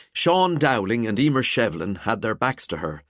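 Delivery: plain reading voice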